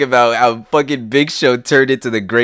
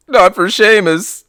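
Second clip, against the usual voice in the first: mock Jerry Lawler; 8 semitones higher, slower than usual. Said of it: "Jerry Lawler says".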